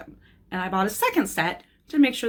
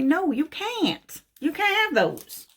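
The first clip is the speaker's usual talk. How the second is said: mock firm refusal; 9 semitones higher than usual. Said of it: "'Cause the answer's gonna be".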